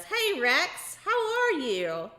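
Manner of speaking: usual speaking voice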